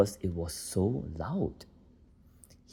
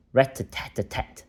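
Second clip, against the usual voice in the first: plain reading voice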